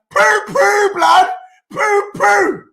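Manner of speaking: high, loud mocking cries; gleeful taunt. Real, not acted. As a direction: jeer at the rival fans. Taunting after goal.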